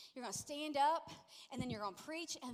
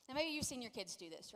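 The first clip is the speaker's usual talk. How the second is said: ordinary talk, nothing marked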